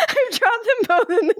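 high-pitched, squealing with laughter; real giggle fit while talking; helpless laughter. Real, not acted. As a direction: barely get the words out for laughing.

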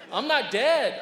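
loud gloating call; loud taunt. Pretend. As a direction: shout it like a proud boast. Jesus acted teasing Pilate.

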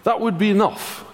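mock-generous, dripping with sarcasm; sarcastic Jesus. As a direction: pretend to offer hope, but mean the opposite. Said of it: "He's being a little sarky".

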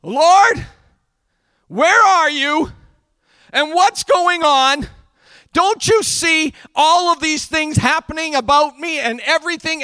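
loud, high acted plea, building urgently then easing at the end; frustrated outcry. Preacher as David demanding answers from the Lord, loud exasperated cry. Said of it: "David just goes".

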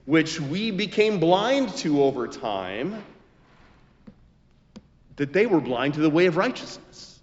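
rising and falling pulpit cadence, swelling on key lines; preaching a sermon. Acted. Pastor preaching to his congregation, full sermon voice. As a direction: preach it, building to the big lines.

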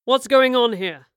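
loud, slow demand; deep demanding voice. Acted. Man striding up to his wife and a stranger, demanding. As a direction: demand it loudly, in a man's deep voice. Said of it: "a deep voice demanded".

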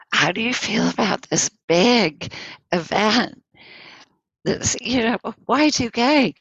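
quoted question, pitched well up; mildly hyped-up imitation of an eager questioner; excited question. Journalists asking the Dalai Lama about Y2K, excited.

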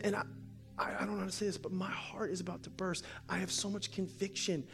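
hushed, halting, near whisper; imitated tearful woman. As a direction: confess it softly as if close to tears. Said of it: "She starts crying".

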